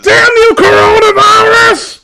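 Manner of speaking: very high, loud wailing howl; mock crying shout. Riffing on the fanfic, she wails a hammed-up curse at the virus. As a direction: scream at the heavens, sobbing theatrically. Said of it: "and I started to cry".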